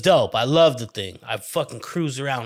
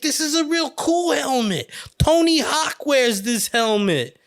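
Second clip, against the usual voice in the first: high mom voice; 12 semitones higher, 3 dB louder than usual. Retold or imitated. Mom coaxing her son into a helmet, high-pitched.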